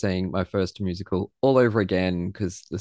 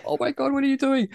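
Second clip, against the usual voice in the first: incredulous; faster than usual. Retold or imitated. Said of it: "And I was like".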